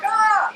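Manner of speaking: short high startled "ah" of sudden pain; pained yelp. Retold or imitated. Client on the chiropractor's table yelps at a touched sore point. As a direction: yelp as if the chiropractor just hit a sore spot.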